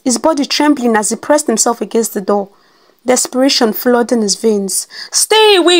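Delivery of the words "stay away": high, loud frightened shout. A narrator's reading. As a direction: yell at it to stay away. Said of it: "he shouted".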